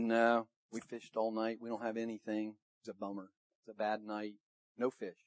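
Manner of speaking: low and very soft, deflated; glum, dejected reply. Acted. Empty-handed fishermen answer the shore, glum and dejected. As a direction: let it sink into a quiet grumble.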